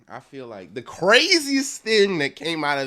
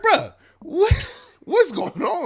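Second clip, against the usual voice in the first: laughing disbelief; slower than usual. Retold or imitated. Host mocking the beef with high laughing disbelief. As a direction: squeak it out in amused shock. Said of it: "I'm like".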